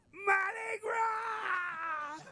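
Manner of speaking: long, high, drawn-out crying shout; ecstatic shout. Retold or imitated. Big Chief welcoming the boy, long high shout. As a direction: stretch it out in a joyful cry.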